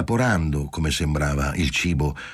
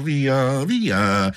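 desperate liveliness; 7 semitones higher than usual. A narrator's reading. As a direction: press her with desperate brightness. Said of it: "con una vivacità disperata".

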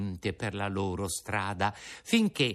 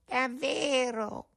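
much higher, very slow wail; echoing cat; slower than usual. Acted. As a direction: stretch the word like a mewing cat. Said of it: "ripeté il gatto".

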